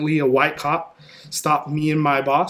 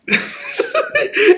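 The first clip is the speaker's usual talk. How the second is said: burst of laughter; 6 dB louder than usual. Real, not acted. Cop refuses turkey bribe.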